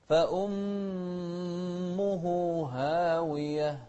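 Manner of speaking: فأمه very slow, sharp hamza. Shown as a demonstration. Teacher chants فأمه slowly for the student. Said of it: "شوف الهمزة".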